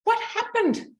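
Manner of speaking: high, stunned what happened; shocked at the mirror. Retold or imitated. Mother seeing herself in the mirror, shocked high voice. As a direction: gasp it out in disbelief at yourself. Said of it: "I looked in the mirror like".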